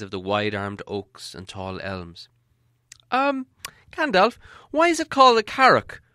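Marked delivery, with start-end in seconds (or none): 3.1-6.0 high, timid little hobbit voice; curious hobbit. Acted. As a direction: ask it up high, shy and curious. Hobbit asks wizard.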